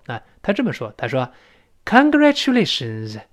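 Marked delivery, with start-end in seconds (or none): 1.9-3.2 slow, higher teacher's model; slow, lifted dialogue reading; model congratulation. Shown as a demonstration. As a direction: draw out "Congratulations" for learners. Models the dialogue line slowly.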